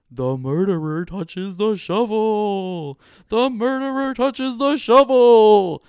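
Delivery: slow, rising ghostly chant; haunting voice. A narrator's reading. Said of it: "they chanted".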